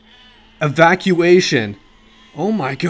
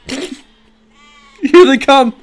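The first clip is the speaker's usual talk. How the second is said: laughing; 7 semitones higher, 6 dB louder, much slower than usual. Real, not acted. Sheep arriving, laughing.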